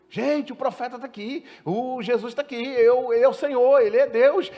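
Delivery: high, excited calling to the crowd; joyful announcing. Acted. Samaritan woman tells her townspeople Jesus is here, high excited call. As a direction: announce the news with joy, pitched high.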